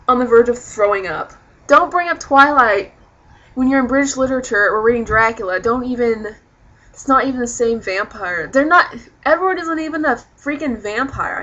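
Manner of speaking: worked-up complaint with heavy emphasis; exasperated rant. Real, not acted. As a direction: protest loudly, fed up. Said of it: "done ranting".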